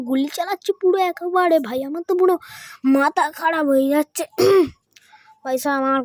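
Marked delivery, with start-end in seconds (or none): none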